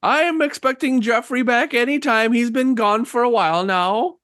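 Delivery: put-on high-pitched old lady voice; old woman expecting her son. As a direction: speak up high as an elderly woman. Game master plays old woman.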